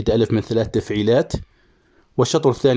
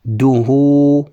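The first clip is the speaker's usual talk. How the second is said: very slow, louder, drawn-out syllables; syllable scanning; 2 semitones higher, 6 dB louder, much slower than usual. Shown as a demonstration. Teacher scanning verse aloud for students, slowly drawn out. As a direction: chant the syllables slowly for the class. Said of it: "والراء ساكنة".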